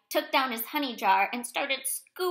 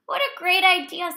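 higher, louder, eager praise; delighted exclamation; 7 semitones higher, 6 dB louder than usual. A narrator's reading. Little Bird approves Bear's honey plan, delighted exclamation.

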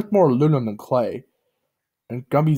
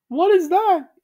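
very high puzzled cartoon voice; squeaky alarmed question; Gumby's high voice; 5 dB louder, slower than usual. A narrator's reading. Gumby sees stubble, high puzzled voice. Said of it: "Gumby said".

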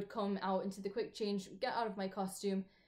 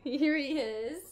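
louder, higher, rising hello to a pet; bright call as the dog appears; greeting the dog; 6 semitones higher, 7 dB louder, slower than usual. Real, not acted. Dog brought on camera.

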